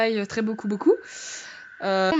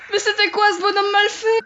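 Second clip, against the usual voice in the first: high, shaken exclamation; shocked outcry; 7 semitones higher, 4 dB louder than usual. Real, not acted. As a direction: cry out just after a fright. Shocked after screaming at scare.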